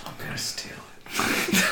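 sneaky whisper; hushed whisper. Pretend. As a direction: whisper a secret plan. Whispered plotting in the silent crowd.

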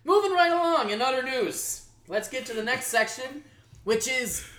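big show-host voice; announcer segue. Pretend. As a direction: sweep grandly into the next segment. Announces the next show segment.

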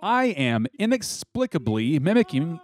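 normal talking voice

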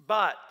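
"But" hit loud and sharp; stressed "But". Shown as a demonstration. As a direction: punch the word "But". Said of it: "you might circle that word".

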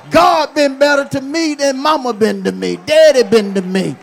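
shouted, high preaching climax. Acted. Preacher shouting to the congregation in full force.

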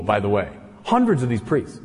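normal talking voice

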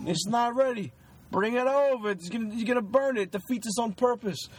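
heated argument. Retold or imitated. Acting a Raging Bull kitchen argument.

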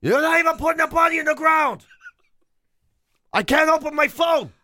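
high, loud mocking yell of a spoilt boss; mock tantrum quote. Retelling the boss's phone demands at a funeral, hammed-up high yell. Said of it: "And he goes".

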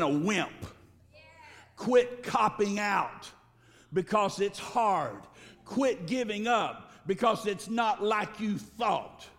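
slow, hammering rebuke; slow and hard, call them out; emphatic preaching. Acted. Preacher scolding the congregation to stop quitting, slow and forceful.

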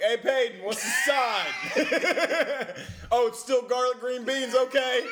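loud, high, laughing call across the restaurant; calling to coworker. Retold or imitated. Server calling to Peyton across the restaurant, loud laughing call. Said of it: "I'd be like".

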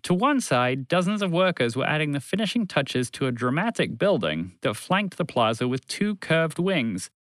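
usual speaking voice

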